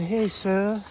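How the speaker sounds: lightly voiced eager boy greeting; imitating Rodney. Boy greeting the gardener, polite and eager. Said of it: "That's how he talked".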